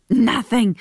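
Nothing. with a short uneasy laugh before it; nervous laugh. Acted. Tim deflects with a laugh.

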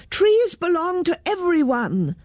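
put-on high-pitched children's slogan; chorus it high, without real volume; storyteller's high mock call. A narrator's reading. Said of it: "called the children".

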